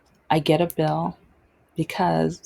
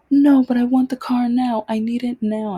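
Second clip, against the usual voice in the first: loud, high whiny demand; imitating a grown man whining for his car; mock tantrum, loud and high; 7 semitones higher, 6 dB louder than usual. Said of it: "he is whining and whining".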